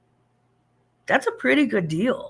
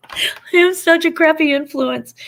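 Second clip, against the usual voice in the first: laughing; 9 semitones higher, 7 dB louder than usual. Real, not acted. Laughs at own joke.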